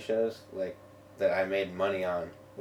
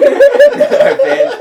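laughing; 18 dB louder than usual. Real, not acted. Laughing at own failed duo.

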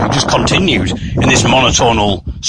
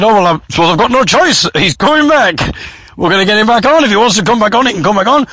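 high-pitched, raised announcer voice; MC giving in; 7 semitones higher, faster than usual. Retold or imitated. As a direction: announce it over the noise, conceding. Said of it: "the M C said".

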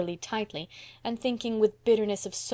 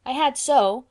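louder, higher insistent retort; crying out in protest; 5 semitones higher, 7 dB louder, slower than usual. A narrator's reading. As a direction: protest it like a contradicted child. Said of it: "cried Davy".

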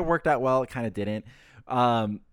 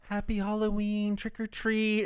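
put-on small kid's high sing-song greeting, softer; Weston's trick or treat; 7 semitones higher, 5 dB quieter, slower than usual. Little boy greeting neighbors at the door, higher and soft. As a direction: chirp it like a shy six-year-old. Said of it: "He's like".

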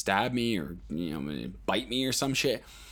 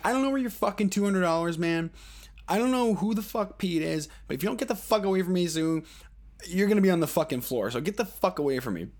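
higher, pushy warning, half-yelled; retold threat; 7 semitones higher than usual. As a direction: warn him off, mildly mock-shouted. Speaker threatens the crackhead.

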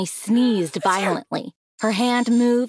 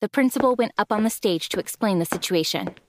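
ordinary delivery, nothing marked

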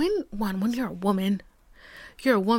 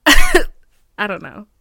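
laughing it off; 12 dB louder, much slower than usual. Real, not acted. Laughing trailing off.

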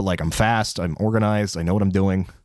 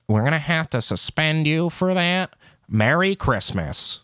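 imitated manager; 8 semitones higher, slower than usual. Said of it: "The way she just said you were suspended".

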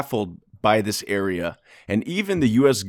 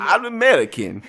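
fake Russian accent; 9 semitones higher than usual. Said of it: "That's not Russian".

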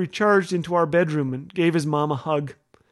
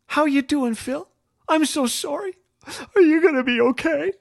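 higher, gently worried sister voice; Ruth's sympathy; 7 semitones higher than usual. Retold or imitated. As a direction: play the caring sister at the bedside. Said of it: "my sister Ruth to sit on my bed and say".

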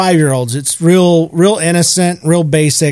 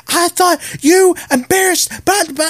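very high imitation of scolding; put-on scolding squawk, far above usual voice; mock yelling; 14 semitones higher than usual. Old lady scolding her dogs, mock angry yell. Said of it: "She's yelling like".

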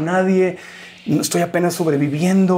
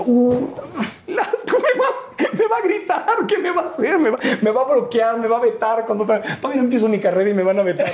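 much higher, laughing mock panic; panicked self-quote; 6 semitones higher than usual. Young host dreading Velasco's anger, laughing high panic. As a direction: squeal the worry while laughing at yourself. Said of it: "Y yo".

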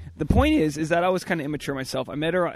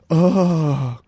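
shocked gasp; 3 semitones higher, 9 dB louder than usual. Retold or imitated. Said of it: "I had this, like, gasps".

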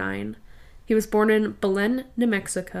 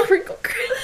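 giggling through the word, pitched up high; laughing; 10 semitones higher, faster than usual. Real, not acted. Laughing at the noisy wrapping, giggling. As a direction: crack up while saying it. Giggling over the crinkly present.

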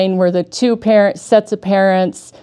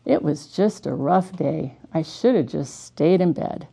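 softer, lower confession; weary retold prayer; 3 semitones lower, 6 dB quieter than usual. Speaker retells a bedtime prayer after a rough day, softly weary. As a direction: murmur it like a glum bedtime prayer. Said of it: "might say a prayer and say".

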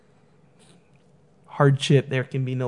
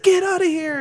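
high-pitched mock shout; a hammed-up, much higher angry order; imitated angry cashier. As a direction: play the fed-up cashier throwing him out. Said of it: "he's like".

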